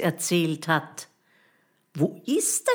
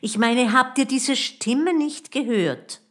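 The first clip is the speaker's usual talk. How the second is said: high, louder urgent question; agitated insisting; 8 semitones higher, 5 dB louder than usual. A narrator's reading. Boy insists to his friends he heard a voice, agitated. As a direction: press the others, pitched up and agitated.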